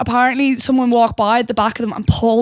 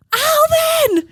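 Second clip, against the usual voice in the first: high squeal of a name; excited call. Retold or imitated. Fan spotting Alvin, squealing his name. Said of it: "some girl walked by and was like".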